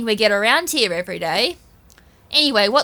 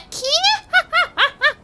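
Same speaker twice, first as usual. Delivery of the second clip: high cackling witch laugh; witch cackle. Acted. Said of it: "Why the witch voice, though?".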